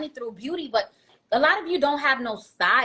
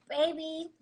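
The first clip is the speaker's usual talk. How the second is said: sweet talk; 3 semitones higher, 8 dB quieter than usual. Shown as a demonstration. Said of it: "you gotta talk like this".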